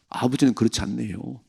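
ordinary delivery

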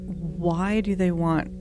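ordinary delivery

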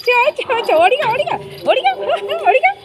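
very high, quick repeated calls; excited urging. Real, not acted. Owner cheering the bathed dog to run, excited high calls. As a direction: egg the dog on in a high squeal.

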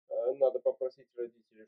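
ordinary talk

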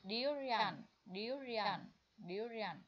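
"durian" repeated slowly and clearly; model pronunciation. Shown as a demonstration. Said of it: "em sẽ đọc là durian". (đọc mẫu "durian" chậm rãi)

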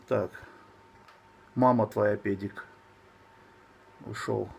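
normal talking voice

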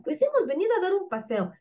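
high, quick grandmother puppet. Acted. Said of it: "La abuela". (abuela con voz aguda y rápida)

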